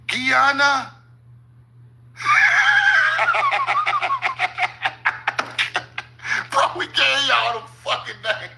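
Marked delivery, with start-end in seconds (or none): 2.2-6.0 long high shouting laugh; real incredulous laughter. Real, not acted. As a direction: howl with laughter. Disbelief at shared names.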